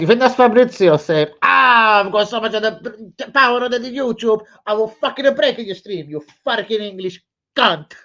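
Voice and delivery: loud, high mocking rant. Ranting at Fabrizio Romano, loud and mocking. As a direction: shout the mockery at him.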